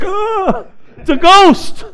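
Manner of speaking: high gasp into a loud cry; mock freak-out. Mourner seeing the dead man walk in, mock freak-out. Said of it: "they were freaking out".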